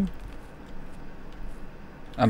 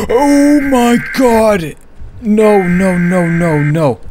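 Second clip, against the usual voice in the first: high, loud, drawn-out panicked cry; terrified scream; 11 semitones higher, 9 dB louder, much slower than usual. Real, not acted. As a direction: scream it as the monster comes. Fleeing the monster.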